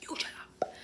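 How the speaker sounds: unvoiced you shut up; petty whispered retort; whispered comeback. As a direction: whisper it back defiantly. Talking back to the guard.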